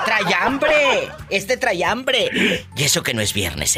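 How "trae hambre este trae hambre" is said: high, laughing teasing jab; laughing at the cheeky guest; laughing tease. Real, not acted. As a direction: tease him through a laugh.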